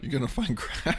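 high disbelieving question; wait, what? in amused shock; incredulous rising question; surprised question. Real, not acted.

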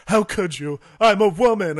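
loud, high fake wounded woman; mock damsel cry. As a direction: wail it like a hurt damsel. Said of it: "she goes".

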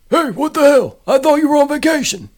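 gruff detective caught off guard; raspy startled cop. A narrator's reading. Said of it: "Al's voice was raspy".

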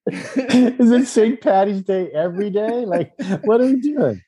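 high, giggling mock question; laughing mockery. Real, not acted. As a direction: make fun of it through laughter. Mocking Gemma's Irish talk.

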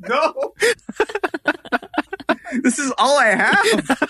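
high, laughing protest; can't believe it, laughing it off; laughing disbelief. Retold or imitated. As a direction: laugh through the complaint in disbelief. Disbelief at going back in water.

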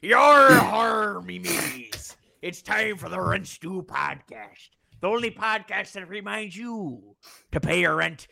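hammy high pirate captain growl. Acted. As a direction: belt it like a cartoon pirate. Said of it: "I can't do a pirate voice".

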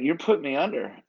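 retold protest, pitched high; mildly alarmed realization. Patient realizes anesthesia is starting.

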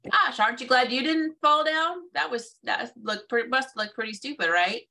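high-pitched jokey disbelief at yourself; self-mocking, laughing it off. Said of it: "you laugh about it and you go".

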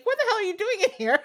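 high, fast, startled outburst; shocked servants. Acted. Servants finding the girl in the fire pit, high and startled. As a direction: blurt it out in shock.

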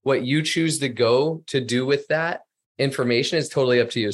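ordinary talk

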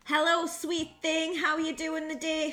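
mock creepy chat-up. Mimicking chat-up lads.

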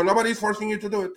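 high, fast mocking parody of politicians. Podcast host mocks politicians' excuse in a fast, high voice. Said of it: "they go".

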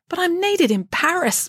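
high, slow plea; pleading. Acted. Friday to head nurse about leaving, pleading. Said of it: "pleaded Friday".

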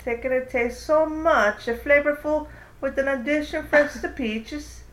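reading a beer label like an ad. Acted. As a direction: sell it like a commercial. Reads beer ad description.

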